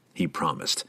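ordinary delivery, nothing marked

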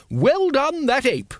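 loud, very high congratulation; hearty cheer. A narrator's reading. Lady Ramkin congratulating the ape, loud and high. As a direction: call out praise like a grand lady.

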